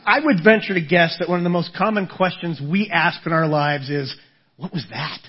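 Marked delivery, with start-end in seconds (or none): 4.6-5.2 low, much softer uneasy whisper of a question; hushed question. Retold or imitated. A listener in bed hushed and uneasy. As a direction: ask it quietly into the dark.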